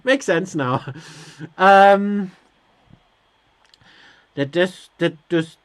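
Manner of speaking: ordinary talk, nothing marked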